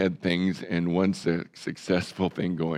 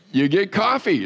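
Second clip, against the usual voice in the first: higher, louder punchline; excited punchline; 11 semitones higher, 5 dB louder than usual. Real, not acted. Preacher telling congregation the coffee joke, bright lift. Punchline about tired driving.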